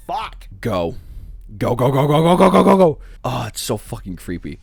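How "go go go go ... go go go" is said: loud rising go go go; panicked chant of go; frantic urging. Real, not acted. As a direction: push them to run, faster and louder. Urging characters to flee.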